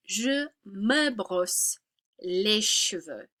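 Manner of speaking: very slow, les cheveux whispered; slow model sentence. Shown as a demonstration. Teacher slowly modeling the French sentence for students. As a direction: spell it out slowly, drop to a whisper. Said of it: "in French, however, you're gonna say".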